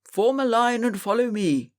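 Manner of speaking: lightly voiced stern teacher; teacher's order. A narrator's reading. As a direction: order them into line. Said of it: "shouted the teacher".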